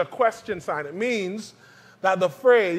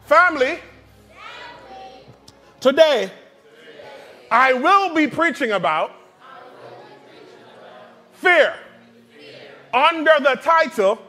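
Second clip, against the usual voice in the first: loud proclaiming; 7 semitones higher than usual. Shown as a demonstration. Preacher shows the congregation how to announce the title, loud and high. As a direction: call it out like a preacher opening a sermon. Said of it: "Say, um".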